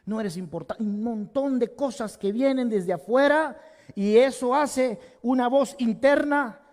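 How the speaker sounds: normal talking voice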